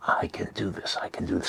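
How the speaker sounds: hushed, whispered self-affirmation; said to oneself. Shown as a demonstration. Coach showing how to affirm in a supermarket line, whispered to oneself. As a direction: whisper it so nobody around hears. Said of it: "Say it to yourself".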